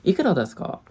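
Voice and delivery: high, loud Ikura desu ka; bright polite tourist question; asking price in Japanese. Retold or imitated. Asking the counter girl the price, high and loud. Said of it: "I asked the girl at the counter".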